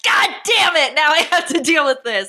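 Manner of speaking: high, fed-up groan of annoyance; exasperated. Retold or imitated. Teen boy fed up at finding a skull, exasperated. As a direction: sigh it like it's the last straw. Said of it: "where I'm like".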